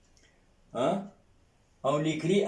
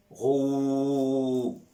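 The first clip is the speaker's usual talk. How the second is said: slow drawn rou. Shown as a demonstration. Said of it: "On prolonge".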